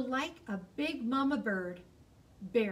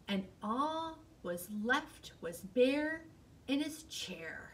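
plain reading voice

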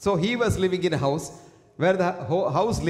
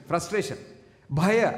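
usual speaking voice

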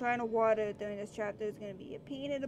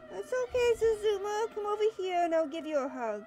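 comforting coo; 8 semitones higher, 4 dB louder than usual. Real, not acted. Player comforting crying Suzuma, high cooing. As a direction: coax him over gently.

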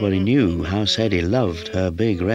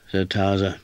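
plain reading voice

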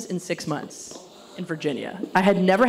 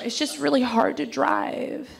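put-on girlish voice, pitched up; helpless little girl; 7 semitones higher than usual. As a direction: play helpless like a small girl. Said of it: "I'm just a little girl".

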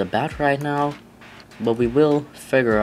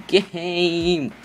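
higher-pitched whine at the game; exasperated plea; 6 semitones higher, slower than usual. Real, not acted. Gamer asking the game why it tortures them, exasperated plea. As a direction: plead with your tormentor.

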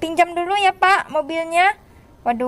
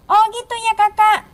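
high little-sibling voice; childlike put-on voice; Mio; 5 semitones higher than usual. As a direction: answer as a small kid, pitched up. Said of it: "Iya, Mio".

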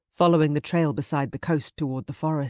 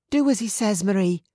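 higher, anxious female voice; anxious urging; 6 semitones higher, 4 dB louder than usual. Acted. Mother urging the girl to obey the attacker, anxious. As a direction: urge her to obey at once.

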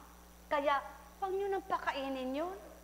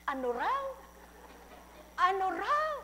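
deaf man's question; 7 semitones higher than usual. A narrator's reading. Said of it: "Sabi naman ng bingi".

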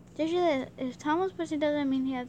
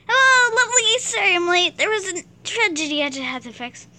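much higher, much louder theatrical entrance; grand entrance; 8 semitones higher, 12 dB louder than usual. Acted. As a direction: sweep in announcing yourself. [name] enters in character.

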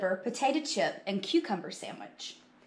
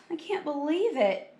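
higher, dismayed voice; sad; 6 semitones higher than usual. A narrator's reading. Buster finding his lunch missing, sad dismay. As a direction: wail it mildly in disbelief. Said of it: "Buster said sadly".

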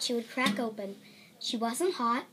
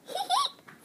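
put-on baby giggle. Baby laughs.

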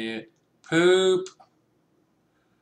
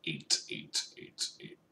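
whispered counting; 11 semitones lower, 13 dB quieter than usual. Real, not acted. Counting while hiding.

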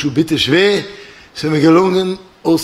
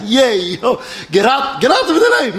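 high-pitched mock cheer; imitated cheering; 7 semitones higher than usual. Whole plane rejoicing at being saved, imitated cheers. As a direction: cheer like a relieved planeload, lightly acted. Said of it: "Jeder schreit".

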